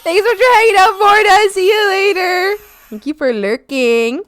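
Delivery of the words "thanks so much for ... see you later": high loud cheery goodbye; bright farewell. Real, not acted. Streamer thanking a viewer, cheery and loud. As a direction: sing out thanks.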